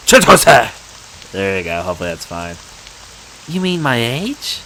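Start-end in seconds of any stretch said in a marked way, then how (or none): 0.1-1.3 Chitose called out much louder. Shown as a demonstration. Gamer drilling the name, shouting Chitose last. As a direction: belt out Chitose chee-TOH-seh. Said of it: "I'll say it, like, five times now".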